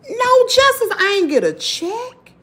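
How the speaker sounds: high, bitter outburst with a broken laugh; bitter anger. Real, not acted. Vlogger telling viewers she got no justice, high and bitter. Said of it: "it just pissed me off so bad".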